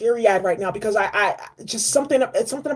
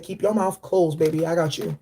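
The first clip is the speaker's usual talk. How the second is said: low tender elder voice; 6 semitones lower than usual. Retold or imitated. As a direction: murmur it low like a protective elder. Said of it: "He said".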